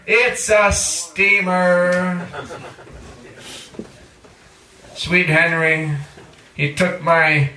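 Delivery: slow, measured spoken-word recital; poetry reading. Acted. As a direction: lean into each image like a beat poet. Poem read to audience.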